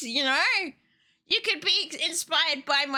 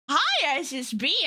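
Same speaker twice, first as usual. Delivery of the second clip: ordinary delivery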